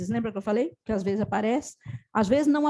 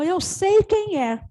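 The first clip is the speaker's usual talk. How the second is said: slow, higher and louder, as if welling up; tearful realization; 6 semitones higher, 5 dB louder, much slower than usual. Retold or imitated. Therapist voicing the crying client's realization, slow and raised. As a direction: let the recognition break out, drawn out. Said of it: "disparou a chorar".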